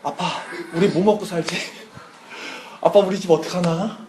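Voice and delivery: lightly voiced child fretting to a parent; a hint of an anxious child; acted worried child. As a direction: play a worried little kid, softening into breath. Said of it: "걔네들이 전도사 앞에서 이러는 거야".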